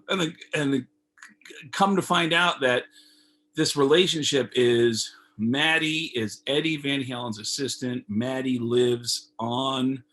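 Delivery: ordinary delivery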